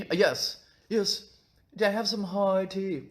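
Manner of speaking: high-pitched put-on British toff; hammed-up upper-class English; mock upper-class British. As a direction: play a fussy British tea drinker. Playing British upper class at tea.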